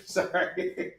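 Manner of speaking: breathy giggling apology; laughing. Real, not acted. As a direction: laugh through the word. Laughing at own joke.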